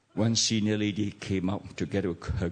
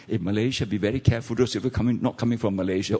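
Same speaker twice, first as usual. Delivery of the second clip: ordinary talk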